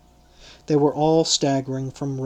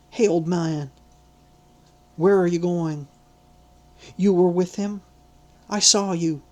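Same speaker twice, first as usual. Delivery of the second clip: higher, insistent accusation; a lightly raised girl's voice, pressing; prying servant girl; 4 semitones higher than usual. Acted. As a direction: corner him with suspicious questions. Second maidservant accusing.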